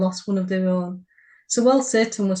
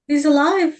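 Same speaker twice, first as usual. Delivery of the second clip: loud, high "he's alive!"; excited exclaim; 7 semitones higher, 6 dB louder than usual. Acted. Crew member shouting as Rudolph springs up, loud and high. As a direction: burst out in amazement.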